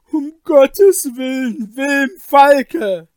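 loud, high scream of despair; desperate cry. Acted. Kaspar begging his friend to stop, desperate shout. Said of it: "schrie er mit der Stimme der Verzweiflung".

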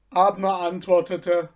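ordinary delivery, nothing marked

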